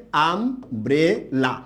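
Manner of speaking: Umbrella slowly as আম, ব্রে, লা, three stops; syllable by syllable. Shown as a demonstration. Teacher showing three syllables, pausing within umbrella. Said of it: "আমরা এখানে তিনবার থামছি".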